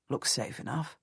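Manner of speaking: low, soft and wary; cautious. A narrator's reading. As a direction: keep it guarded, checking for danger. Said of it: "my husband says cautiously".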